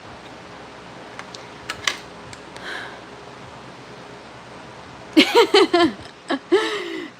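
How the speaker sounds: breathless, high unvoiced laugh; a helpless silent laugh at the lid. Real, not acted. Streamer laughs at her own fumbling, breathless laugh. Struggling with humidifier lid live.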